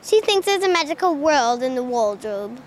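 low, careful try at English accent; copied English accent. Shown as a demonstration. Pupil copying the Narnia line, attempted English accent. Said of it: "I like the English accents from that one".

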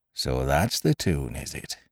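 low, slow and soft; understated, drawn-out pirate reply; knowing pirate retort. A narrator's reading. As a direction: let it sink in quietly. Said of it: "cried the cook".

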